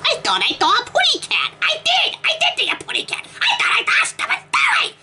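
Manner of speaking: very high cartoon bird squeak; Tweety voice. Quoting the story's characters, Tweety-high. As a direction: chirp it like Tweety. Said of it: "talking like bloody Tweety".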